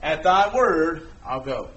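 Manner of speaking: slow, softening resolve; a touch of reverent surrender; humble surrender. Retold or imitated. Peter's surrender voiced.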